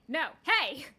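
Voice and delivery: loud call to stop someone; shouting. Real, not acted. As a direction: call out after him. Calling after the bellboy.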